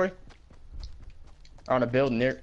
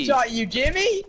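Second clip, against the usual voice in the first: mocking whiny yell; 11 semitones higher, slower than usual. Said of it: "He said".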